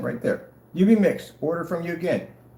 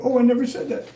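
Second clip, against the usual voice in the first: mock complaining seller; 7 semitones higher than usual. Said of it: "change it at the last minute and go".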